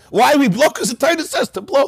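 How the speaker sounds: high-pitched, indignant retort; acted upset. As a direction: protest as if the question is absurd. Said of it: "So the Gemara gets upset".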